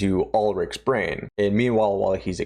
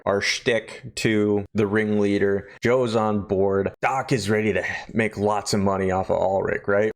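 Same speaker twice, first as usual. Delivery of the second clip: usual speaking voice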